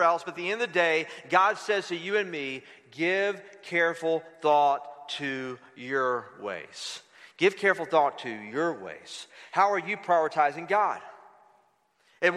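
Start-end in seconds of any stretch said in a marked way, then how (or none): 2.9-7.0 very slow, low, word by word; slow solemn pronouncement. Acted. God's word, drawn out, then repeated. 7.4-9.3 low-pitched, at an everyday pace; solemn restatement. Acted. Same verse repeated at ordinary pace.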